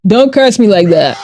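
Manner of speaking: ordinary talk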